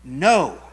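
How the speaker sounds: "no" hit hard and loud, falling; emphatic no. Shown as a demonstration. Preacher to congregation, punching the word "no". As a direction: land "no" like a verdict. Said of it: "an emphatic".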